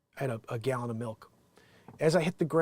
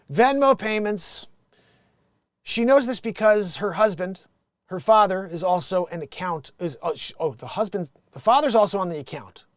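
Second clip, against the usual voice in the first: loud, raised read-out voice; letter read aloud; 7 semitones higher, 12 dB louder than usual. Acted. Host reads a Dear Abby headline, projected. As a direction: announce the letter to the audience.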